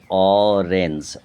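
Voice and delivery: অরেঞ্জ stretched slowly as অ, রেঞ্জ; split in two syllables. Shown as a demonstration. Teacher breaking a word for pupils, অরেঞ্জ slow in two parts. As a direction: draw out both beats of অরেঞ্জ. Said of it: "দুটো ভাগ".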